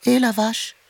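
very high little hail; high-pitched kitten character; small kitten voice. Acted. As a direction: call out in a tiny kitten voice. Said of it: "une petite voix derrière elle retentit".

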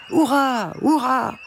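high-pitched crowd cheer; cheering hurrahs. A narrator's reading. Birds applauding the duck, high cheering voice. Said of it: "les oiseaux poussèrent des hurrahs".

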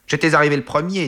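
high, fast protest; quick high-pitched comeback; quarrelsome retort. A narrator's reading. Son squabbling with his rival over a job, fast high retort.

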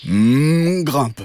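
lightly voiced cow with mooing hum; a hint of a cow character. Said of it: "dit la vache".

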